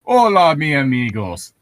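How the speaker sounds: put-on a playful Spanish-accented TV host greeting; a jokey Spanish dub announcer voice; mock Spanish dub. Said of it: "Tom's voice had been dubbed over in Spanish".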